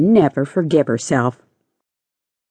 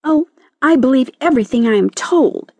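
high-voiced, wounded caterpillar insistence; earnest protest; 9 semitones higher than usual. A narrator's reading. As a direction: insist on your own trustfulness, pitched high. Caterpillar protests against lark's reproach.

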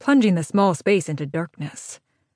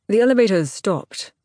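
clipped English voice, a little raised; clipped English accent; 2 semitones higher than usual. A narrator's reading. Man reporting into the emergency phone, clipped English accent. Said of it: "said in a clipped English accent".

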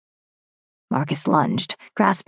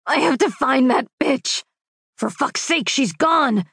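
sobbing rage; 6 semitones higher than usual. Acted. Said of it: "Thick sobs replaced the sting".

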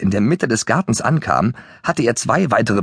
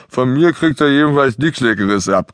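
higher, grumbling character voice; grunted grumble; 4 semitones higher than usual. A narrator's reading. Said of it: "Tetje grunzte irgendwas". (grunzend gegrummelt, leicht erhöht)

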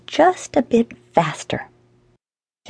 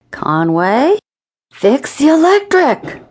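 loud rising call, then a high, drawn-out holler; top-of-lungs yell; 7 semitones higher, 4 dB louder, slower than usual. A narrator's reading. Boss yelling out the window to Conway, top-of-lungs yell. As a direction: bellow it at full volume, stretching the words. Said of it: "yelled at the top of his lungs".

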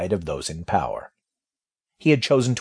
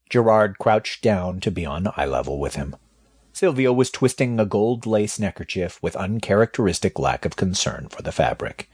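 usual speaking voice

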